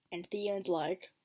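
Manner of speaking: ordinary delivery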